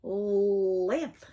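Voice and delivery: "Lamp" with a long, held L; L sound demo. Shown as a demonstration. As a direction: lean on the L before finishing "Lamp". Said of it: "what else has an L sound".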